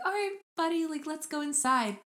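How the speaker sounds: high, gently coaxing mom voice. Retold or imitated. Mom steering her little boy indoors, high coaxing voice. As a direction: coax a small child along, pitched high. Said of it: "the mom was like".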